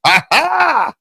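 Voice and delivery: high, loud "ha ha!" imitation; mock triumphant laugh. Host mimicking a fighter springing up, mock triumphant laugh. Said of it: "he came straight up like".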